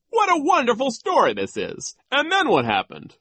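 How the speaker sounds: high, loud approval; pompous delight; gleeful vice principal. Acted. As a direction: applaud it with relish. Voices delighted Nero.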